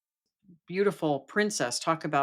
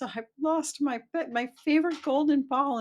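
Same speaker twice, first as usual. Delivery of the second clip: high-pitched, mock-distressed princess; lamenting princess; 12 semitones higher, 5 dB louder than usual. Princess tells the frog of her lost ball, high lament. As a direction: play a little storybook princess bewailing her loss.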